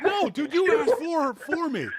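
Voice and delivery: put-on high, loud childish protest; childish whine. Arguing with friend like a whining four-year-old. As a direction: whine like a kid refusing. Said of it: "I'm being a child".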